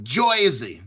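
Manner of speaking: "Joisey" in a put-on New Jersey accent. Joking to viewers about his own New Jersey accent. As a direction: playfully exaggerate the Jersey accent. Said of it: "Is that my Joyzy accent?".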